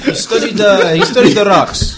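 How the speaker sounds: hammed-up Russian accent; a put-on Russian accent, laughing. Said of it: "in my terrible Russian accent".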